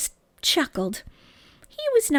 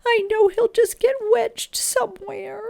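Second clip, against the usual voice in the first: much higher, tearful; tearful worry; 11 semitones higher than usual. A narrator's reading. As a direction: fret through tears. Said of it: "Missus Little began to cry".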